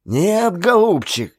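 proud, lightly angry refusal; indignant host. A narrator's reading. Koso indignant reply. (хозяин гневно отказывается, громче)

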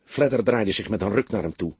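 usual speaking voice

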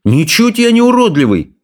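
indignant. Acted. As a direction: protest it, stung by the insult. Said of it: "возмутился Икинг". (возмущённо)

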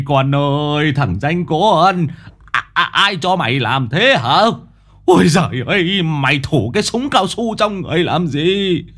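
put-on grandpa half-wailing, half-scolding the boy. A narrator's reading. (ông ngoại vừa xót vừa mắng cháu)

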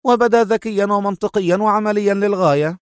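high-pitched prompt reply; high, ready female answer; hurried reply. A narrator's reading. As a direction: jump in quickly to concede. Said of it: "قالت في سرعة".

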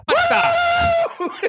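high, loud astonished cry; shocked shout. Acted. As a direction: yelp in disbelief. Shocked machine operator.

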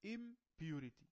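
slow teaching "Impurity", prefix held apart; prefix set apart. Shown as a demonstration. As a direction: break "Impurity" in two: high IM, then purity. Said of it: "نحذف ام".

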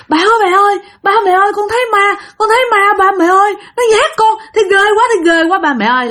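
terrified screaming. Acted. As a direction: scream for mum and dad after seeing a ghost. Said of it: "bạn la".

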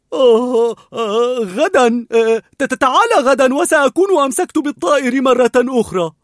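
a frightened dog making excuses; nervous stammer. A narrator's reading. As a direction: panicky high stammer. Scared dog stalls his leader.

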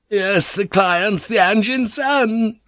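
put-on a young girl's voice; Kiku. A narrator's reading.

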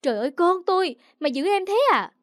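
high-pitched, outraged cry; acted stepmother exploding at the child; outraged scolding. Stepmother rages over hurt baby.

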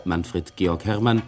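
normal talking voice

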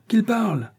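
insistent shout. A narrator's reading. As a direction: push harder the second time. Demand repeated, rising.

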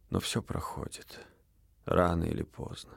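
soft hush. A narrator's reading. Quiet consolation after fever.

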